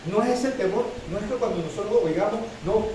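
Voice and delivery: normal talking voice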